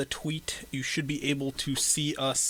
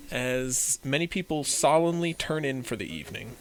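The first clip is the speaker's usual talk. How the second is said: storyteller recap, slower and deliberate; game master narration; 2 semitones higher, 4 dB louder than usual. Acted. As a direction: set the scene for the players.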